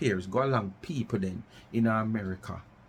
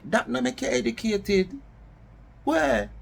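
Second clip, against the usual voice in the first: exasperated outburst; 10 semitones higher, 3 dB louder than usual. Real, not acted. Dismissing fake educated talk.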